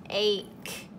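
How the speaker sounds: sounding out the ending ache; isolated ending. Shown as a demonstration. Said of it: "keep saying the word".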